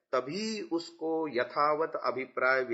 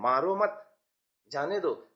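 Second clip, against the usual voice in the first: मारो मत joined and rising, break, जाने दो falling; merciful pause after मत. Acted. Teacher acting out mercy for a snake, pause after मत. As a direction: plead lightly, pause between मारो मत and जाने दो. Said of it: "आप दया दिखा रहे हैं और कह रहे हैं".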